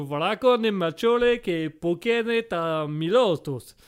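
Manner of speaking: slow gibberish in a hammed-up Italian accent; sing-song mock Italian, drawn out. Examiner rambles in hammed-up mock Italian. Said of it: "Tellement mélodieux".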